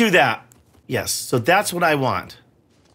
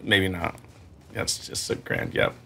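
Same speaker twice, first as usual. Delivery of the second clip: whispered, much softer, dry sarcasm; sarcastic whisper; 9 semitones lower, 10 dB quieter than usual. Real, not acted. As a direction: breathe it out bitterly under your breath. Sarcastic aside after dying.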